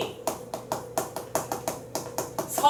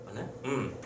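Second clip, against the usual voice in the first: ordinary delivery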